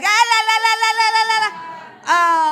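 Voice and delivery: loud high singsong la la la; daughter covering ears. Retold or imitated. Daughter refusing to hear, loud la la la. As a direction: drown it out like a teenager. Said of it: "she was like".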